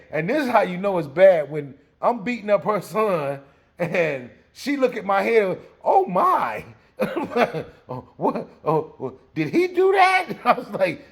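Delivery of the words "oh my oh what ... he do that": high, startled gasps with a laugh; mother's high shocked outcry. Retold or imitated. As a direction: react in shock to the bald spot.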